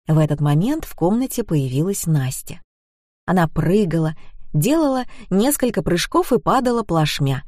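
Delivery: ordinary delivery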